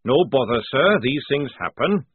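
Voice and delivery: barely polite sergeant; suppressed fury. A narrator's reading. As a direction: swallow the anger. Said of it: "said Jackram, furiously tugging".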